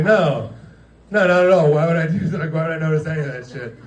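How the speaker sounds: high, defensive, last line fast and laughing; mock defensive. [name] answering John about the guest at the bar, mock defensive. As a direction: brush it off indignantly. Denying noticing celebrity.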